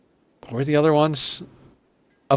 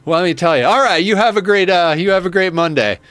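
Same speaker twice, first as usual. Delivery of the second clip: chummy fake small talk; 6 semitones higher than usual. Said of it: "He's like".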